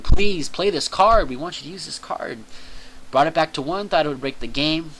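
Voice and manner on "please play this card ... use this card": hammed-up begging that starts loud and eases off; mock screaming plea. Konami begging players to use the card, mock screaming. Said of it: "Konami's just screaming".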